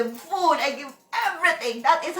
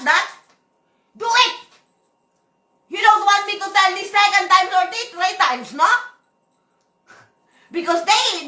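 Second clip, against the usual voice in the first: loud, higher-pitched scolding; angry rant; 5 semitones higher, 5 dB louder than usual. Real, not acted. Boss scolding her employees, loud and angry. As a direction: raise the voice and lay down the law.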